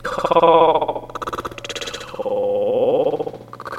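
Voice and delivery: "cock talk" stretched out very long; slow-motion edit on "cock talk"; drawn-out stretched playback. Pretend. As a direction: drag each word of "cock talk" out, rising at the end. Mashup stretches the repeated phrase.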